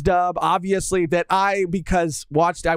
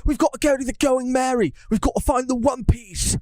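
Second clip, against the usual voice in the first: higher-pitched mock British accent; British Sanji; 4 semitones higher than usual. Predicting live-action Sanji to co-host, hammed-up British accent. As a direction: ham up a British accent, calling out to the crew. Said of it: "he's gonna have a British accent".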